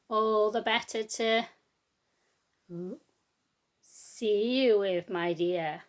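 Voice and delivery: slow drawn-out wolf voice; the wolf answering about its eyes; sly wolf. Acted. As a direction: stretch each word menacingly. Wolf's reply.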